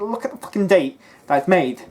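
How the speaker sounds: loud, heated callout; furious loud defence; angry rant. Real, not acted.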